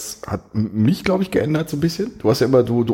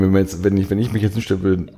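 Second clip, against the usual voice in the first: usual speaking voice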